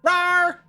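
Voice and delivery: high, loud dinosaur roar. Acted. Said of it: "I have to roar at Fetch".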